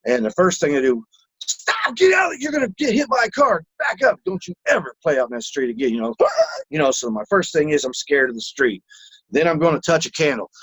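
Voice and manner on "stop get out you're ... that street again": parent yelling warning. Retold or imitated. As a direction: shout like a scared mom at a kid near traffic. Parent yelling at child in story.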